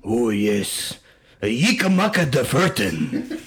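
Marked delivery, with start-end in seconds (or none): 1.4-3.4 a lightly put-on Japanese Hitler accent, slow Ich mag die Fertig; mock Japanese Hitler accent. Host doing the requested Japanese Hitler accent, slow and laughing. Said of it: "in Japanese Hitler accent".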